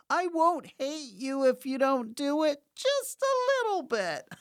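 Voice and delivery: high squeaky joking falsetto; playful high-pitched whine; mock squeal. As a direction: climb into a silly, piercing high voice. Mock threat, then says joke.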